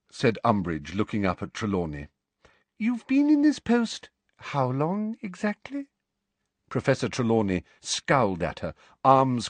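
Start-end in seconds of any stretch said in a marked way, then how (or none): 2.8-5.9 high, slow, sugary official questioning; prim inquisitor voice. A narrator's reading. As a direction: ask slowly with a high prim voice. Umbridge questions Trelawney.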